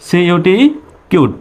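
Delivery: ordinary talk, nothing marked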